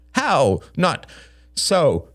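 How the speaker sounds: high, protesting cry. A narrator's reading. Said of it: "cried Greg".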